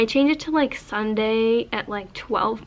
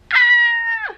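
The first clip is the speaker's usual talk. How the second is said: sudden high shriek of delight; excited shriek; 6 dB louder than usual. Real, not acted. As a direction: let out a squeal of shock. Shocked by huge palette.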